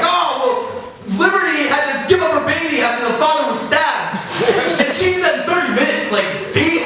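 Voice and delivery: high, loud sarcastic sing-song; mocking parent. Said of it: "And I'd be like".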